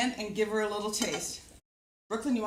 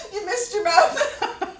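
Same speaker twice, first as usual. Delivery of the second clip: laughing through the words; slow, amused laugh; 9 semitones higher, 5 dB louder, much slower than usual. Real, not acted. Laughing at helper missing mouth.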